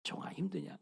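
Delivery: soft unvoiced whisper of God; God's whisper. God answering the weeping missionary, soft whisper. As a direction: breathe it like a voice from heaven. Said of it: "음성이 들린 거야".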